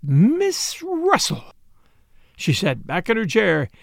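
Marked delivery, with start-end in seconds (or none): 0.0-1.5 high, very drawn-out, sibilant reveal; triumphant hiss. A narrator's reading. Sister brings out her climax, hissing triumphantly. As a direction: hiss every S of the name slowly. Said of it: "She hissed in the most approved style".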